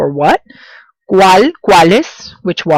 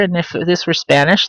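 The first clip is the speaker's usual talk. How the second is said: normal talking voice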